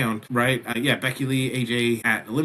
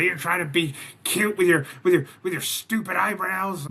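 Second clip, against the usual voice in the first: mild mock tantrum, pitched higher; retold temper tantrum; 7 semitones higher than usual. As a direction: imitate someone's petulant fit. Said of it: "Becky just is just throwing a temper tantrum".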